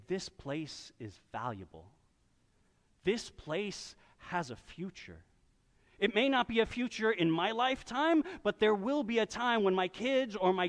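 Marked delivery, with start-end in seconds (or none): 0.1-5.2 quiet conviction. Retold or imitated. Preacher voices Jeremiah's quiet declaration of hope. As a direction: say it softly, as a firm promise. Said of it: "using his money to say".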